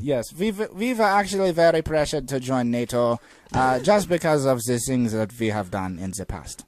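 put-on German accent; mock German. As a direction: explain it as a German caricature. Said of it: "Germans always sound like there's somebody just squeezing".

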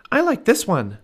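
put-on high excited girl's voice; little girl Annie. Annie calls to Jack, high excited little girl. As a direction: Annie calling out, bright and high.

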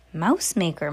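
plain reading voice

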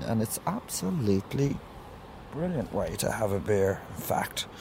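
drifting off into a murmur; fading to quiet. Real, not acted. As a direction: let the words fade to almost nothing. Relaxed aside fading away.

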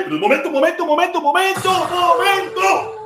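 building excitement, stretch the last momento; excited shout. Real, not acted. Sudden excitement before the goal. (grito emocionado que sube)

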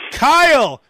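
high, slow call, loud on the name; fake radio call-out. Host pretending to summon engineer Kyle, calling out. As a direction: holler to an engineer behind the glass. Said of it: "like Kyle's some engineer behind the glass".

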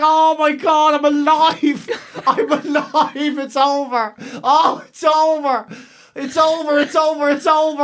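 relieved joy. Retold or imitated. Patient after the catheter, laughing with relief. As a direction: breathe out that it's finally over.

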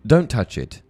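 a sudden pained yell. A narrator's reading. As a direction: shout it through the pain. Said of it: "Harry yelled".

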